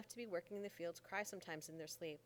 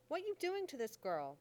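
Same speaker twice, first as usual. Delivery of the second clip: loud, higher furious demand; angry shout; 6 semitones higher, 5 dB louder than usual. Acted. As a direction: yell at him to stop, outraged. Mammy catches John teaching.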